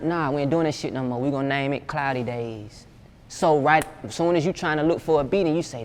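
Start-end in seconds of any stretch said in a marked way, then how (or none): none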